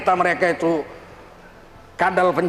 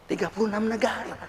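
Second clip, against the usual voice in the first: much softer, murmured repeat; quiet echo; 9 dB quieter than usual. Real, not acted. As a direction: let the boast fall to a soft echo.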